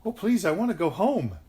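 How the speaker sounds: put-on high, loud little-girl plea, mildly tearful. A narrator's reading. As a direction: plead as if starting to cry. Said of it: "started to cry and said".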